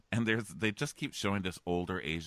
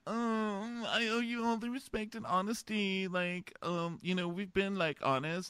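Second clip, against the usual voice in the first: high, halting weepy imitation; mock tearful; 11 semitones higher than usual. Man dumping the contestant cries for sympathy, mock tearful. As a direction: cry harder so they can't yell at you. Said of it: "where he cries harder".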